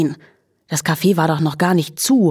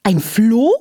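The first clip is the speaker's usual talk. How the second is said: horrified; 5 semitones higher than usual. A narrator's reading. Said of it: "wieherte Charlotte entsetzt".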